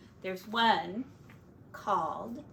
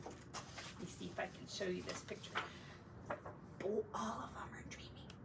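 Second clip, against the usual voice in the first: hushed whisper; 12 dB quieter than usual. Real, not acted. Parent whispering to child about the picture, soft whisper. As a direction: whisper so as not to break the calm.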